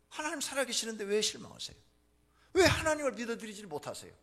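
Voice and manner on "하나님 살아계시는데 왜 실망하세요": usual speaking voice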